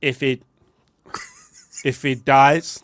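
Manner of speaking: halting attempt at a Russian movie villain; tentative Russian accent, broken off; attempted Drago. Shown as a demonstration. Trying the Drago quote, hesitant Russian accent. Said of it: "not mocking the Russian man".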